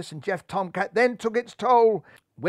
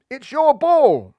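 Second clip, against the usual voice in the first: high, loud, slow yell; urgent call across the field; shouting; 10 semitones higher, 12 dB louder, slower than usual. A narrator's reading. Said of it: "shouted Buntham".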